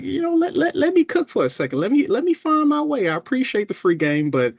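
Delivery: high, pleading coworker voice, begging for room; overwhelmed new coworker. Retold or imitated. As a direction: play the swamped new hire asking for space. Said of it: "you're like".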